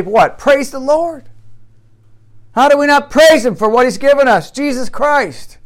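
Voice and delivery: high, urgent preaching with loud bursts; fervent exhortation. Real, not acted. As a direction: press the congregation with rising pulpit fervour.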